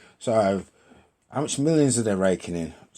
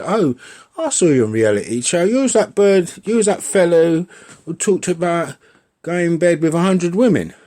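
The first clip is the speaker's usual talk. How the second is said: higher and louder, nosy stranger calling you out; mock stranger taunting; 6 semitones higher, 5 dB louder than usual. Stranger in the cafeteria recognising a reality star, mock accusing. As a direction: play the gawking member of the public.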